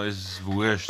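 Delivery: low, quiet indifferent grunt; drunk man's reply. Retold or imitated. Drunk man in the joke shrugging off her period, low and quiet. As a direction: mumble it like a drunk who doesn't care. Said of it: "er sagt ja extra noch".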